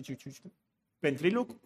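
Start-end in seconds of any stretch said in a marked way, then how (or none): 0.0-0.5 ventriloquist mimic. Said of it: "los muñequitos y hablan así".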